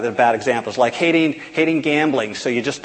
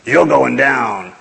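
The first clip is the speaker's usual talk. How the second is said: slow menacing boxer's taunt; Mister T taunt; much slower than usual. Retold or imitated. Retelling Rocky three, the big scary fighter taunting, slow. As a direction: stretch it out like a tough fighter. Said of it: "Mister T says".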